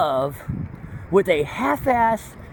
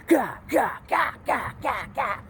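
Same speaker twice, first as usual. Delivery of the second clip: mock stabbing grunts; 5 semitones higher, 6 dB quieter than usual. Imitating stabbing sounds.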